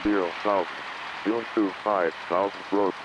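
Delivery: usual speaking voice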